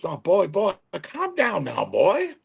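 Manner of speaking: high-pitched mock calming voice; high, drawn-out retold reply; imitated opponent. Acting out the opponent hushing him.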